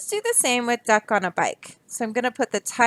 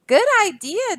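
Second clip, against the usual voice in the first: animals praising; 9 semitones higher than usual. Acted.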